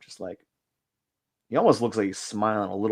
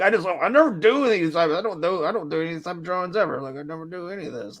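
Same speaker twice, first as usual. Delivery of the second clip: mock exasperated self-quote; 8 semitones higher, faster than usual. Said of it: "I'm like".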